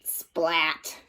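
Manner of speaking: ordinary delivery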